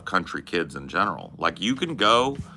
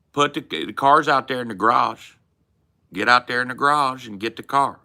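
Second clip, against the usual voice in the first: high, loud country holler; country family accent; 5 semitones higher, 4 dB louder than usual. Acted. Ordering someone out to the garage, country accent. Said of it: "When I'm talking like this".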